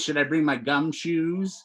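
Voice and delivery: normal talking voice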